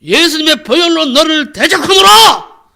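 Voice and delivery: high, booming, drawn-out exorcism shout. Retold or imitated. Pastor rebukes the demon harder, full-force commanding shout. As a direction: hurl the rebuke with all your might. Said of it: "더욱 힘있게".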